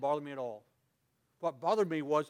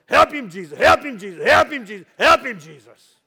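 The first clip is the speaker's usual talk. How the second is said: loud, higher church lady cry; screaming plea; 4 semitones higher, 19 dB louder than usual. Retold or imitated. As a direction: scream it out to heaven, over and over. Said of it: "And they were screaming".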